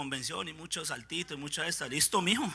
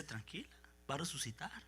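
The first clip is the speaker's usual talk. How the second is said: consuelo tranquilo; 5 semitones lower, 19 dB quieter than usual. Acted. Jesús consuela a Marta.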